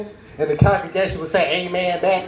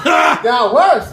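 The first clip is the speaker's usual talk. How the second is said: slow emphatic; 4 semitones higher, 5 dB louder, much slower than usual. Real, not acted.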